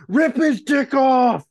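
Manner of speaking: high, drawn-out heckler's shout; crowd yell. Retold or imitated. Man in the crowd heckling the fighters, loud high yell. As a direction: bellow it like a rowdy fan in the stands.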